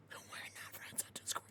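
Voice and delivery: whispered through a laugh. Real, not acted. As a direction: breathe it out, amused. Laughing aside about Discord.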